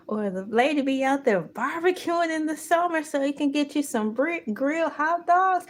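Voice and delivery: ordinary talk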